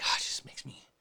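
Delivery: whispered, a hushed confession of anger; confiding whisper. Retold or imitated. Sharing anger with a trusted friend, in a hushed whisper. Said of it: "you can just share with somebody".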